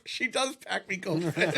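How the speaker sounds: laughing through the line; laughing while quoting. Retold or imitated. Cracking up while quoting Snacks, laughing.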